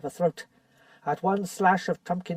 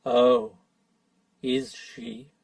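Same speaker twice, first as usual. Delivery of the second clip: werewolf's sinister reply; grey terrible voice; 7 semitones higher, 3 dB louder, much slower than usual. Acted. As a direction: menace in two words. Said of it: "The grey and terrible voice".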